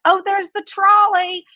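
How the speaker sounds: loud, high imitation of an excited guy; excited call-out. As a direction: holler it to your rowdy friends. Young guy announcing trolley to friends.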